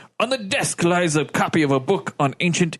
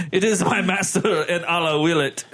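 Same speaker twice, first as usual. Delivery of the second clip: ordinary talk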